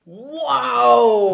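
loud, high astonished Wow!; genuinely stunned; real amazed wow. Real, not acted. Hearing about the crying actress, astonished wow. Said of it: "Oh my god".

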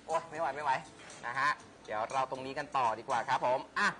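normal talking voice

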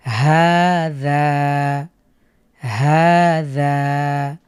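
"হাযা" read very slow, both vowels held a second; stretched vowels. Shown as a demonstration. Teacher models the long vowels of "হাযা". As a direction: drag out each aa in "হাযা". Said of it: "দুটোই এক second করে long করব".

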